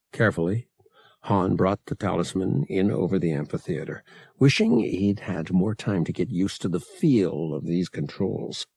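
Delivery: ordinary delivery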